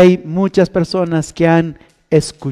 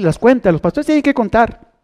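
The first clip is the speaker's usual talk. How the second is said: usual speaking voice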